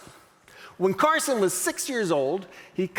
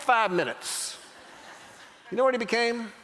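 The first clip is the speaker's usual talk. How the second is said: ordinary talk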